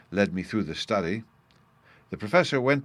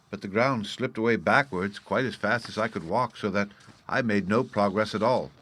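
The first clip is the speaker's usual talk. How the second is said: plain reading voice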